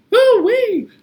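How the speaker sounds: high, loud falling whoop; hoo-wee whoop. Acted. Teacher whooping in a clapping rhyme.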